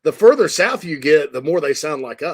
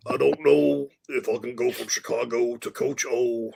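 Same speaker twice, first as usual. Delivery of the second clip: ordinary talk, nothing marked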